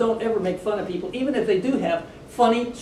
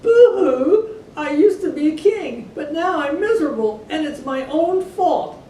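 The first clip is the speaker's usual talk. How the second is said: high, loud hammed-up boo-hoo sobbing; wailing; 7 semitones higher, 4 dB louder than usual. A narrator's reading. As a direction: wail like a miserable dethroned king. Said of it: "he wept and wailed".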